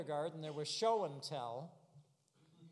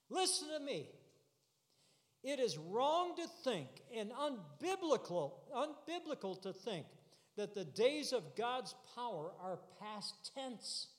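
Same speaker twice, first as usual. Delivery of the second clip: high-pitched pulpit insistence; insistent preacher, pitched up; urgent preaching; 5 semitones higher than usual. Real, not acted. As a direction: demand the congregation's attention and press the point.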